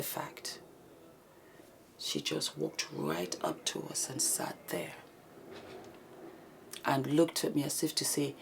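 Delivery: breathy whisper recounting a scary encounter, slow at times; hushed whispered storytelling. Acted. Telling viewers what happened to her and Karine, in a tense whisper.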